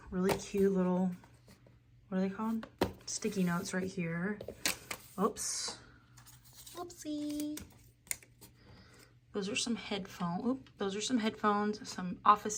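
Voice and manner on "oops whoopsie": cutesy whoopsie. Real, not acted. Dropped something.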